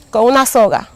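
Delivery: ordinary delivery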